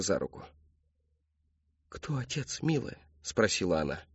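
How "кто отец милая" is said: soft, higher, tender; gentle motherly question. A narrator's reading. Mother asking her daughter, soft and tender. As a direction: coax her softly.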